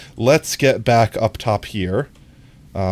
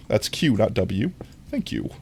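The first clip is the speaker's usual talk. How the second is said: usual speaking voice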